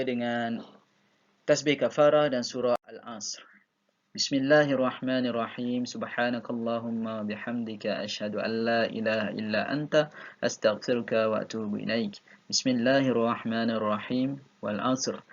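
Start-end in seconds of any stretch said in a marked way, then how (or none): 4.2-15.2 rapid, fluent Arabic recitation; fast memorised recitation ending the class; prayer recitation. Acted. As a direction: rattle off the closing prayer quickly. Closing recitation.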